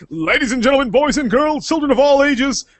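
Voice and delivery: announcer voice. Acted. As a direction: build it up like a ringmaster. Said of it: "I'm gonna start it like this".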